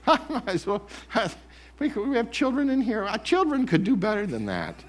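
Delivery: high, teasing accuser; mock scorn from the accuser; accuser's voice. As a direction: needle him playfully as the accuser. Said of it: "the accuser's voice".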